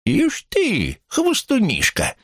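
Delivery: very high-pitched acted cry; old man's shout back. A narrator's reading. Uncle Andrews calls back to his neighbour, high cry. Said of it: "закричал в ответ дядюшка Эндрюс".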